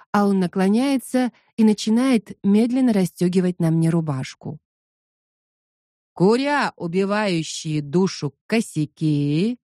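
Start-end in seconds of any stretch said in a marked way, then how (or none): 6.1-9.6 slow, ominous propaganda line; slow dramatic reading. Acted. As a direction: draw it out like a scary old film. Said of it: "медленно произносит Шон". (медленно, зловеще)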